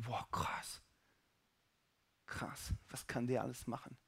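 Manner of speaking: hushed, low, near-whispered wonder; very soft, stunned awe; awestruck. Acted. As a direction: breathe it out in reverent amazement. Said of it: "ein ehrfürchtiger Moment".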